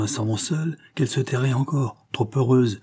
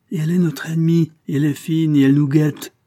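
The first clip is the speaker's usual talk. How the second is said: higher, insistent listing of dangers; mounting alarmed insistence; heated warning; 5 semitones higher, 5 dB louder than usual. A narrator's reading. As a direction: press the warning home, raised voice. Nephew warns uncle of enemy.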